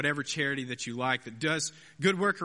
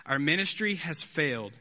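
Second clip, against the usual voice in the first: reading Spurgeon aloud; 3 semitones higher than usual. Retold or imitated. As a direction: read it like a Victorian preacher in full flow. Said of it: "Spurgeon, eighteen eighty-one, he says".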